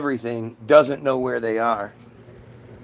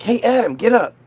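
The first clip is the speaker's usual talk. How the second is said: lightly voiced Eve, higher pitch; acted wake-up call; 7 semitones higher than usual. Eve waking Adam up, acted wake-up call. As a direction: call him to get up.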